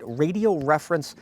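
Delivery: usual speaking voice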